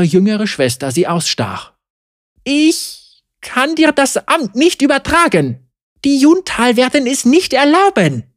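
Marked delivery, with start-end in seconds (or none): 2.4-8.2 higher, hesitant and timid; hiding behind the law, unsure. A narrator's reading. Mara refuses her sister, timid and higher. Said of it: "sagte Mara zaghaft".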